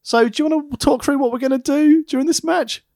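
lightly voiced ringside chat; a touch high, casual question. Manager asking partner about the match, a touch high. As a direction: ask it breezily, a little high. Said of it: "I was like".

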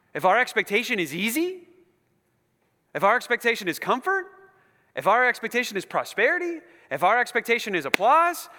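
high, loud preaching in a driving cadence; impassioned preaching. Real, not acted. Preaching at full force.

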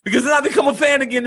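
ordinary talk

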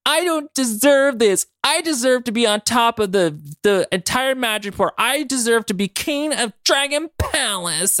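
mock whiny crying. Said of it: "runs over and he's crying".

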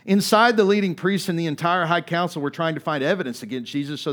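loud, driving preacher's reading; preaching the Bible story at full force; forceful scripture reading. Acted. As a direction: proclaim the passage to the congregation. Reads the scripture passage with force.

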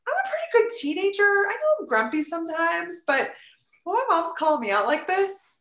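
put-on high-pitched hurt teenager, quickened at first; teen self. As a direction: voice the wounded teen inside. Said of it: "'Cause I remembered feeling like".

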